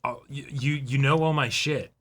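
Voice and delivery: normal talking voice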